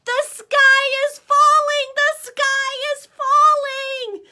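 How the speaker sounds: high loud panicked scream. Acted. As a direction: scream it together in terror. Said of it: "screamed as they ran".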